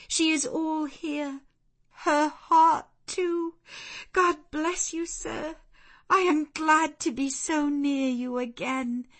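high, heartfelt reunion voice; joyful emotion. A narrator's reading. Jane joyfully reunites with her master.

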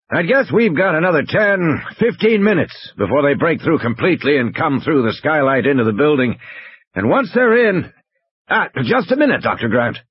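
high-pitched urgent estimate; tense game warden briefing Grant; Muldoon on the radio. Acted.

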